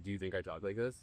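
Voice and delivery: mock a low voice to show it off; deep straight-guy voice. Shown as a demonstration.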